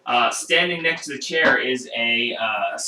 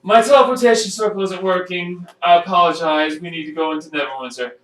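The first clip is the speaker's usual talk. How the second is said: higher, louder character voice; raised voice, hurrying toward the end; explaining in character; 5 semitones higher, 5 dB louder, faster than usual. Acted. Perrin apologizes and asks passage to Neverwinter, pressing.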